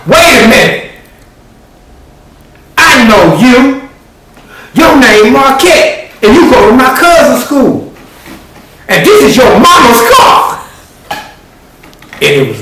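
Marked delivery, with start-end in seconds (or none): 0.1-10.7 much higher, loud accusing shout; girl's excited shout. Acted. Girl in the back seat recognizing Marquette, loud high shout. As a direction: blurt it out, loud and high, catching him out.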